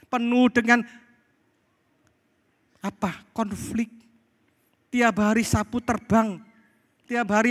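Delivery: high-pitched, emphatic sermon lament. Real, not acted. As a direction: preach it high, driving the point home. Preaches with raised voice.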